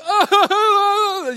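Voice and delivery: high, long hammed-up "oh"; loud mock wail. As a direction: imitate breaking down in a big wail. Acts himself losing it.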